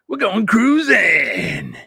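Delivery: drawn-out, high, loud cheer; excited. Real, not acted. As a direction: stretch it out like big news. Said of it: "I'm excited about this".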